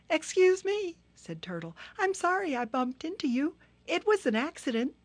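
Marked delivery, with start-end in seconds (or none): 0.1-1.0 high, apologetic little turtle; Turtle's voice. Acted. Small turtle excusing herself to Hippo, high and polite. 2.0-4.9 high, apologetic little turtle; Turtle's voice. Acted. Small turtle excusing herself to Hippo, high and polite.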